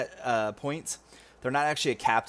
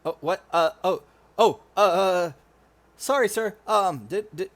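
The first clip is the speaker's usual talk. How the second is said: put-on flustered young soldier tripping over his words, higher pitched; nervous stammering soldier; 4 semitones higher, 4 dB louder than usual. Nervous soldier apologizing to a superior officer, stammering.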